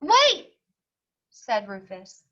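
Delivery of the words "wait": high, loud sudden "wait"; eager call. Acted. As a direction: stop him with an eager shout. Rufus interrupts principal.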